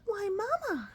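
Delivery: high little-bat voice. Acted. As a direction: pipe up like a tiny bat.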